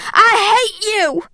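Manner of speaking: high, drawn-out cry of hatred; restrained scream. A narrator's reading. Girl lashing out at her father, restrained scream. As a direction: scream it without full volume. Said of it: "I screamed".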